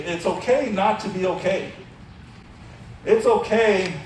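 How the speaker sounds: normal talking voice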